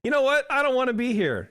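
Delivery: put-on high, loud child's declaration; quoting his kid. Father voices daughter saying she wants to leave, high and loud. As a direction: proudly voice the kid's firm refusal. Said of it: "proud of my kid for being like".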